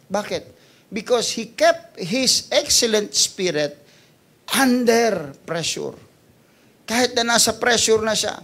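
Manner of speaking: high, loud preaching push, slowing on key words; full-force preaching. Acted. Pastor urging the church to keep excellent spirit, full-force preaching. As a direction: preach it out to the congregation.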